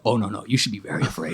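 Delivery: low, soft acted warning; grave warning. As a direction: imitate owners warning him, voice dropped. Horse owners warn him in story.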